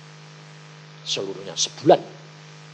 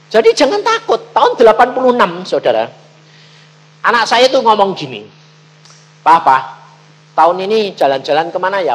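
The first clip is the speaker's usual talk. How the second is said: loud, high, driving sermon telling; preaching at full force; 6 semitones higher, 11 dB louder than usual. Acted. Preacher to congregation, telling of his Australia trip, loud preaching. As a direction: belt the story out to the congregation.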